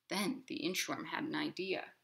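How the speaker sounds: ordinary delivery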